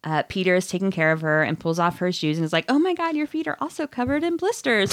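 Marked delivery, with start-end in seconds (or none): 2.7-4.9 put-on fussing boyfriend. Peter fussing over blistered feet.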